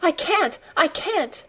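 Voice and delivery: very high, broken little pleas; acted tearful child; gasping and sobbing. A narrator's reading. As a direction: whimper it helplessly, high in the voice. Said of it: "he gasped and sobbed".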